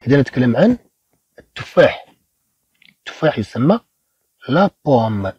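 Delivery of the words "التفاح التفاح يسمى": ordinary talk, nothing marked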